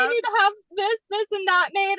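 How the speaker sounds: high, mock-whining list; comically swamped by demands; overwhelmed whine.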